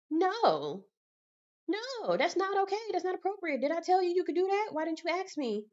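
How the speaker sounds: high-pitched, affronted objection; appalled refusal, voice jumping high; indignant scolding. Retold or imitated. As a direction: tell them off, pitched well up. Scolding friends who grab her.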